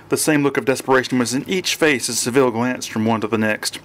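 usual speaking voice

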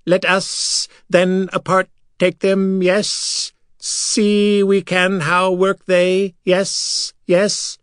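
high, slow, curious alien voice; high slow creature voice. A narrator's reading. Silver Sand proposing to the others. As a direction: stretch the words out high and curious.

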